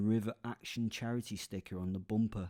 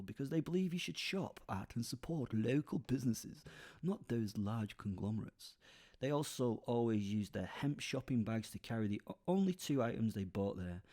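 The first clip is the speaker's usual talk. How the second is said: plain reading voice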